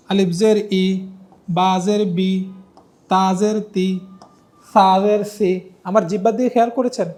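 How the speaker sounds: slow, clear letter-by-letter recitation; recitation drill. Shown as a demonstration. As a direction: model each sound for students to repeat. Teacher drills Arabic letter sounds.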